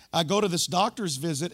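usual speaking voice

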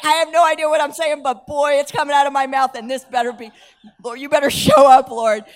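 half-panicked loud plea, push it out; loud urgent plea. Retold or imitated. Pleading inner voice, loud.

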